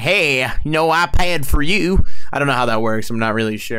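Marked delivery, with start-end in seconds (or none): 0.0-2.0 slow, louder put-on Iowan accent; mock Iowa accent. Co-host guesses how Iowans speak, mock Iowa accent. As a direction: drawl it out as a pretend Iowan. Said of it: "is that how they speak in Iowa".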